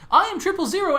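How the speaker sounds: high, squeaky protocol droid; fussy droid voice. Acted. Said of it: "I can do it better".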